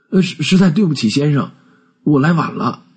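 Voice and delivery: high-pitched, stammering apology; flustered apology. Acted. Clerk caught coming late to his boss, flustered and apologetic.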